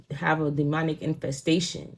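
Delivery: usual speaking voice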